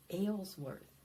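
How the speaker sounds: ordinary talk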